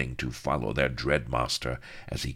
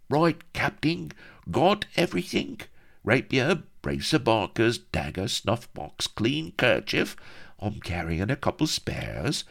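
high, chirpy sidekick; eager halfling; 7 semitones higher than usual. Acted. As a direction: bustle about the captain, pitched high. Halfling sidekick checks captain.

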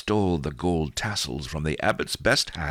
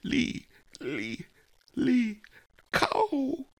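dying cry; much slower than usual. Acted. Dying woman crying out.

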